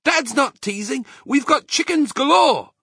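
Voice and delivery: high-pitched, loud cub voices; small foxes crying out. A narrator's reading. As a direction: chirp up eagerly like little fox cubs. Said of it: "cried the small foxes".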